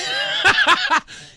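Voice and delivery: high burst of real laughter. Real, not acted. Reacts to drug deal joke.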